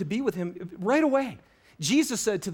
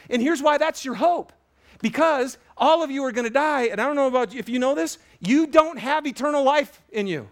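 full-force preaching; 6 semitones higher, 5 dB louder than usual. Acted. Pastor urging congregation, full-force preaching. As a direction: preach it hard to the room.

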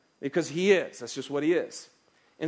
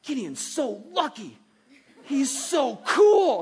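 slow, higher imitation of a little girl, swelling louder; child's voice; 6 semitones higher, 5 dB louder, slower than usual. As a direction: mimic the daughter's wide-eyed admiration. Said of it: "[name], just said".

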